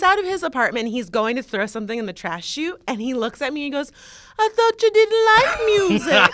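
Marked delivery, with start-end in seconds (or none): 4.4-6.3 high, loud imitation of a smug neighbor; mock smug. Woman mimics neighbor catching her singing, smug high voice. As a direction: put on a gloating, caught-you voice. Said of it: "he said it so smugly".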